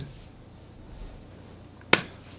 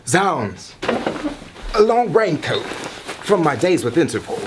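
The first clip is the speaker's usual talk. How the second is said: louder, higher, slow and grand hero voice; melodramatic spy hero; 6 semitones higher, 4 dB louder, slower than usual. Acted. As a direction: proclaim each word like a matinee adventurer.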